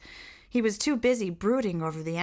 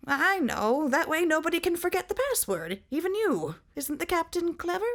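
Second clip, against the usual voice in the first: high eager voice; proud and simple, high; high proud sentry; 11 semitones higher than usual. Acted.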